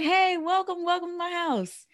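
high sing-song host greeting; booming sing song welcome. Retold or imitated. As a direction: greet them like a jolly host. Host greeting guests at his house.

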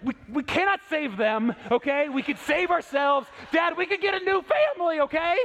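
hammed-up terrified daughter pleading; a dad hamming up his daughter's panic on a hike; mock panicked kid. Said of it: "she was basically saying".

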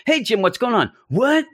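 high-pitched, hammed-up outrage at an unwelcome friend; a comic book brush-off, not in earnest; mock angry outburst. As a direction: throw the friend out with mock fury. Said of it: "but it's the one you're like".

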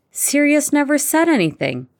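high-pitched indignant protest. A narrator's reading. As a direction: object as if unfairly blamed. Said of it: "Harry protested".

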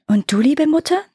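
daughters' question, lightly called out; mild calling out. A narrator's reading. Daughters ask their fairy mother about herself, calling out mildly. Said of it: "riefen sie wie aus einem Munde".

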